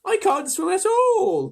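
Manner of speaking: very high, loud and slow wail; crying ladybird. A narrator's reading. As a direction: wail it helplessly. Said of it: "cried the ladybird".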